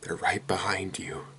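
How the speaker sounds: hushed unvoiced whisper; creepy whispered warning. A narrator's reading. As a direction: breathe it as a secret. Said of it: "The librarian whispers".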